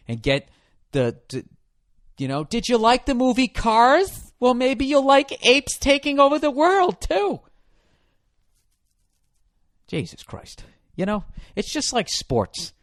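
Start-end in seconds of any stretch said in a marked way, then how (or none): 2.4-7.4 slow, high, salesy mock pitch; mock studio pitch. Comic mocking studio marketing, high salesy pitch. 9.9-10.6 exasperated whisper. Real, not acted. Exasperated aside.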